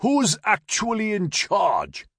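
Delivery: high, drawn-out yell; yelled back. A narrator's reading. Soldier on the ferry to the officer on land, yelling back. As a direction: call it back slowly, pitched right up. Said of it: "he yelled back".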